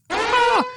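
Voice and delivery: sudden high-pitched yelp, loud; high loud squeal. Retold or imitated. Retelling her shock at his confession, high loud shriek. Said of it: "Sorry if that was loud".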